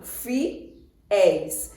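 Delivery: "fiéis" split into fi, then éis with a gap; syllable by syllable. Shown as a demonstration. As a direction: break "fiéis" in two: fi... éis. Splits word to count syllables. ("fiéis" separado em sílabas: fi... éis)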